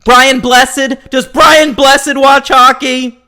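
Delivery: loud, high, drawn-out shouted question; mock booming query. Pestering co-host for British hockey fans, loud and hammed-up. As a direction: belt out the famous name, high and slow.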